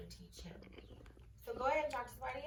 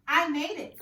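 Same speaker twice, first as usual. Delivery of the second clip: firm, louder reply; boy answering; 6 semitones higher, 12 dB louder than usual. Acted. Boy answering the king, louder and firm. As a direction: answer plainly but firmly. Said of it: "said the boy".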